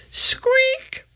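tiny high mouse squeak. A narrator's reading. The mouse in the treehouse, high squeak.

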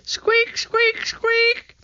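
quick run of very high squeaks; piping mousy chorus; mouse squeaks. A narrator's reading. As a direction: squeak in a row like little mice. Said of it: "they said together".

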